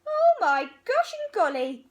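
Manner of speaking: high, loud cry of surprise; shocked elephants squealing; startled exclamation. Acted. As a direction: gasp it out as if you just jumped.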